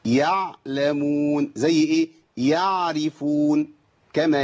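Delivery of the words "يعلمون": يعلمون slow with a clean ain; correct ain. Shown as a demonstration. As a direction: stretch يعلمون, ain intact. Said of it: "دي ما تنفعش".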